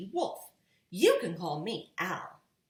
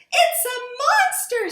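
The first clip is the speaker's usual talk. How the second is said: much higher, louder frightened squeal; scared mother voice; 14 dB louder than usual. A narrator's reading. Missus Blair guessing a monster, frightened and high. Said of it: "said Missus Blair".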